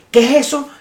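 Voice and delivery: high, loud mock Mexican voice; a Mexican man telling a visitor about the market, imitated high and loud; imitating a Mexican. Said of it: "el mexicano me dice".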